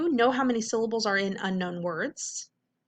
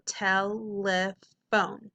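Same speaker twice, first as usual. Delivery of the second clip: telephone slowly in three beats, clean final N; slow, separated tel-le-phone; careful syllable beats; much slower than usual. Shown as a demonstration. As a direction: stretch telephone as tel-le-phone without adding a sound. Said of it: "when you're doing it like this".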